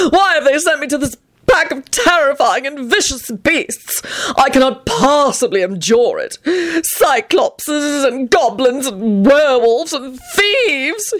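high, horrified theatrical wail; melodramatic trill. A narrator's reading. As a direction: trill it out like an overwrought victim. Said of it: "he trilled out melodramatically".

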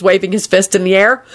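usual speaking voice

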